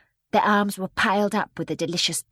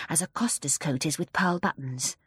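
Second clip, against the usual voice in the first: ordinary delivery, nothing marked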